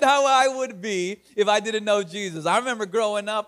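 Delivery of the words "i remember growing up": usual speaking voice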